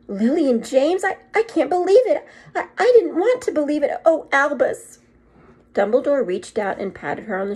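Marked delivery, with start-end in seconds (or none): 0.1-5.0 high, loud and stammering in shock; stricken. Acted. Professor grieves the Potters to Albus, shocked and stricken. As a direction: disbelief breaking through.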